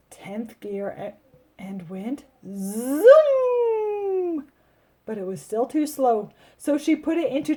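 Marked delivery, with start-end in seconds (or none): 2.5-4.4 stretched zoom. Acted. Wheelchair in tenth gear.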